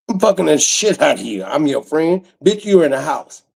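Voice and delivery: normal talking voice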